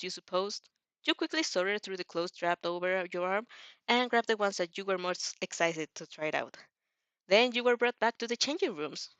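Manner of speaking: ordinary delivery, nothing marked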